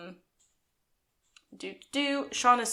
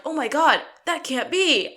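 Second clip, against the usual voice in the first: higher and louder gasped; shocked disbelief; 7 semitones higher, 5 dB louder than usual. Retold or imitated. Viewers react to the video, higher and louder. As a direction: react like the floor dropped out. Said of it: "we're like".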